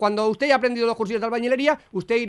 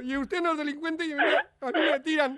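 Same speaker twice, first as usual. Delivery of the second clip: higher, hammed-up weepy voice; fake tearful; 5 semitones higher than usual. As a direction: melodramatic teary self-sacrifice. Prankster hams tearful sacrifice. (sollozo de broma)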